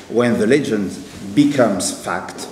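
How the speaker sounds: English line in a strong French accent. Real, not acted. Lecturer quotes the film's famous English line, French-accented English. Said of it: "excusez mon accent anglais qui est épouvantable".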